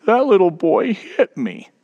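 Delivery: mock small child's hurt wobble; quivering lip. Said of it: "With a quivering lip".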